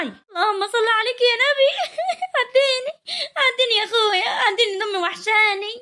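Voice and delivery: high tearful pleading; weeping. Acted. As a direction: plead to get through, crying for your mother. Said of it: "شفتي خليت امك عيطت ازاي؟".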